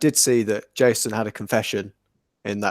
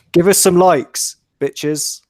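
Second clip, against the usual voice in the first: loud cheeky demand; 6 semitones higher, 5 dB louder, slower than usual. Pretend. Begging viewers for likes.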